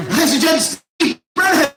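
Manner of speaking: compère hyping up the next act; announcer shout. Real, not acted. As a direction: bellow the name out to the crowd. Host introduces next act.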